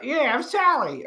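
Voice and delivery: high, slow put-on voice; drawn-out, high-pitched mock introduction; celebrity's mother. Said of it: "You know, like".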